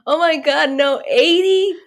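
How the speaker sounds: high, loud shocked repeat; aghast disbelief. Real, not acted. Player hearing she is now eighty, high horrified disbelief. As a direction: gasp it out in horror.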